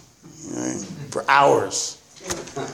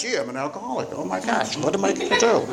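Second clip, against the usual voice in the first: mock dismay; 3 semitones higher, 7 dB quieter, faster than usual. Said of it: "Then they realize".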